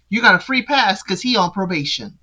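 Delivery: usual speaking voice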